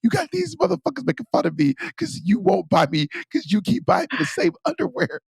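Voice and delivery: high, wailing complaint voiced for a crying man; a crying man ranting at his wife, high and whiny; mock whiny rant. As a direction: whine it way up high, hammed-up.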